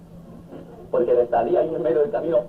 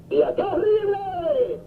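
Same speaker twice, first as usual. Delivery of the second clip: put-on high scandalized wail of the older brother; pious brother crying sacrilege; outraged cry. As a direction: cry out in shocked horror, much higher.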